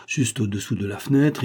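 usual speaking voice

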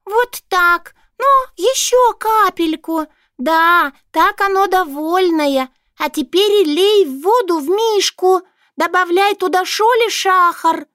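high, squeaky commanding voice; a tiny boss giving orders; bossy little mouse. A narrator's reading. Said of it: "командовала важная Норушонка".

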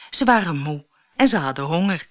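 plain reading voice

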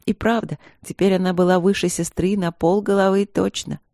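ordinary delivery

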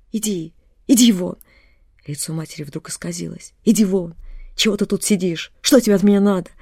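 loud, harsh shouting; sudden furious shout. A narrator's reading. Delirious mother suddenly shouting at her daughter, furious. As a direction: drive her out with rage.

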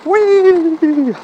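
high, loud, delighted squeal; gleeful whee. Real, not acted. Speeding on the bike.

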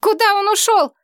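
high frantic cry; desperate shout. A narrator's reading. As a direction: shout it up in despair. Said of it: "в отчаянии крикнула".

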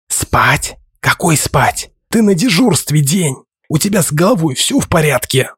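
stunned he'd dare sleep on duty; indignant disbelief. A narrator's reading. Slavka indignant at him.

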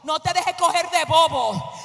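ordinary talk

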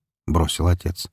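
ordinary delivery

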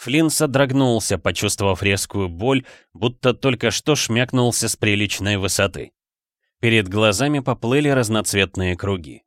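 usual speaking voice

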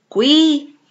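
ordinary delivery, nothing marked

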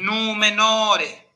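"nu menore" lento, con U y O alargadas; dos vocales largas. Shown as a demonstration. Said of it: "lo pronuncio con los dos acentos". ("nu menore" con dos acentos largos)

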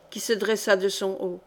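ordinary delivery, nothing marked

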